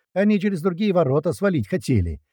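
high-pitched report called over the radio; mild radio shout. A narrator's reading. Fighter reporting to the chief by radio, calling out. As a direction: call it out urgently, pitched high. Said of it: "громко, прокричал также по рации Корж".

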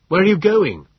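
high, loud shout after someone; calling out. A narrator's reading. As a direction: call urgently up the beach. Calling after woman running off.

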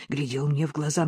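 plain reading voice

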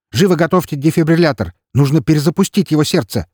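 высоко и громко, командно; отдавай срочные команды; громкий приказ. Acted. Главврач командует в реанимации. (главврач кричит приказы бригаде)

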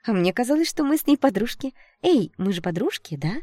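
high, sugary false innocence; insincere, sing-song sweetness; fake surprise. A narrator's reading. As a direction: feign friendship, pressing for agreement. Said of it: "фальшиво удивилась Шленская".

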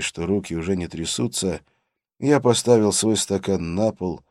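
ordinary delivery, nothing marked

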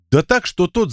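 plain reading voice

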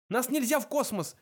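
high, urgent plea; desperate protest; pleading. A narrator's reading. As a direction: beg for your life, voice pushed up. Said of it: "взмолился Форд".

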